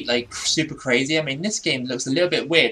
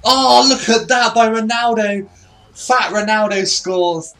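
higher and louder, a delighted yell, the last words slow; excited shout; 8 semitones higher, 7 dB louder than usual. Real, not acted. Gamer cheering a Ronaldo goal, excited shout. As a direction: cheer the goal like a fan at the telly.

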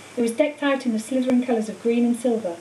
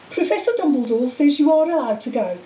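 sniffy, disapproving higher-pitched matron; 4 semitones higher, 4 dB louder than usual. A narrator's reading. Madame Pomfrey disagreeing with Dumbledore's permission, sniffy. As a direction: grudgingly pass it on with disdain. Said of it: "she said sniffily".

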